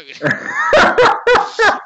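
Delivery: high loud burst of laughter. Real, not acted. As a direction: crack up laughing. Laughing at own story.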